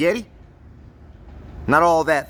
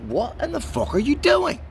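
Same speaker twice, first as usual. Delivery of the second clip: incredulous; 11 semitones higher than usual. Retold or imitated. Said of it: "I'm like".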